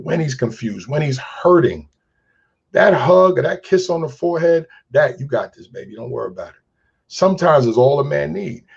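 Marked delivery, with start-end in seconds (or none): 5.2-6.6 low, soft imitated comforting; soothing reassurance. Woman comforts a hurting man, soft soothing voice. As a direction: hush it down and reassure tenderly.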